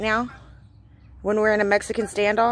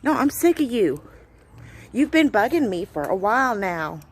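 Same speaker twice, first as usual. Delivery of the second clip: ordinary talk, nothing marked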